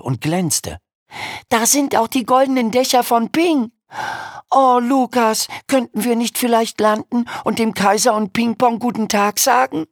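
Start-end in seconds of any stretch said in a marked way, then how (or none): 1.5-9.9 put-on high, hopeful boy's plea; eager boy voice. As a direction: plead brightly like a young boy. Jim's eager pleading to Lukas.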